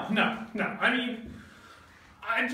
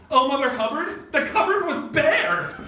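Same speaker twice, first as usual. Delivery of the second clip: mock dramatic; 5 semitones higher, 5 dB louder than usual. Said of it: "and I was like".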